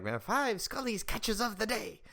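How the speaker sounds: high, slow put-on movie voice; repeating that guy. Repeating a guy from the movie, high slow imitation. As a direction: stretch it out up high. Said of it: "I'll just repeat that guy".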